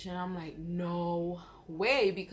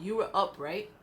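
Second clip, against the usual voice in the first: ordinary talk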